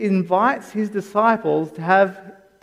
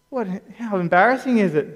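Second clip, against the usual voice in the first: ordinary delivery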